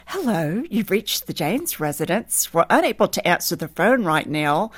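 ordinary talk, nothing marked

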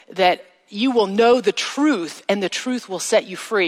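usual speaking voice

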